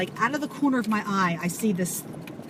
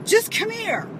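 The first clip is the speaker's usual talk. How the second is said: high, loud call; urgent insistence; 13 semitones higher, 4 dB louder, slower than usual. Retold or imitated. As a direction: insist she come over. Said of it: "She goes".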